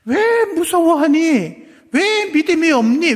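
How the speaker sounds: Jesus rebuking the disciples, slow and loud; rebuking question. Acted. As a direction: scold them for their fear. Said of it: "책망하는 말씀".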